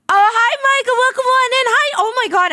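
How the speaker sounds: high-pitched happy squeal; excited greeting. Real, not acted. Streamer spotting Michael join, excited high greeting.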